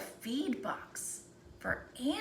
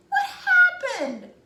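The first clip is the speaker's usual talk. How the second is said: far higher and louder, baffled, a laugh in it; bewildered cry; 12 dB louder than usual. Acted. Third tree finding herself cut into beams, high bewildered cry. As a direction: cry out in disbelief.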